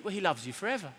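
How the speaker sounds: ordinary delivery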